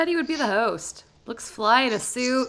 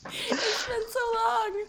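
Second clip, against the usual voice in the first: laughing through tears; 11 semitones higher, slower than usual. Real, not acted. Laughing-crying over the game.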